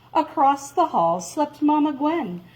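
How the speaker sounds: ordinary delivery, nothing marked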